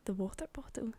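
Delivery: soft, hushed query; whispered question. Real, not acted. Twin checking which secret story is meant, whispering. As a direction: check quietly with your twin.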